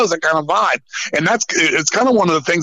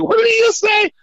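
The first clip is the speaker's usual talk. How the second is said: much higher, shouted disbelief; shocked outburst. Retold or imitated. Bassist Les reacting to a threat, shocked shout. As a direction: yelp it like you can't believe your ears.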